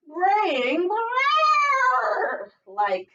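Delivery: long high brrr bray, very slow. Acted. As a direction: stretch out a silly donkey bray. Bray sound acted.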